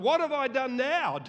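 loud, high whiny protest; mock indignant whine; exasperated protest. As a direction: complain like a wronged little brother. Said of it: "David said".

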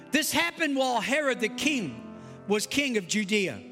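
ordinary talk, nothing marked

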